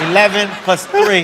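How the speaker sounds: normal talking voice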